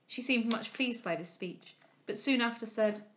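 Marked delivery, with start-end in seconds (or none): none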